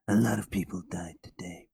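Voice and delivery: whispered tough-guy rasp; Snake Plissken whisper. Retold or imitated. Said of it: "That's not bad, to be honest".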